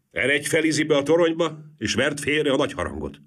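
urgent, authoritative instruction; brisk command. A narrator's reading. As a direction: send him off at once. President orders the bell-ringer about.

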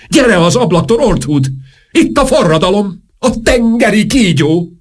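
panicked cry. Acted. King calls the duchess away from the window, high and panicked. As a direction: call out in high-pitched panic.

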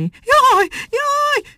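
very high shrieking jaj. A narrator's reading. Monkey shrieks as the rabbit hops closer. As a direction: squeal it out in terror. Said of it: "sikoltotta Muki".

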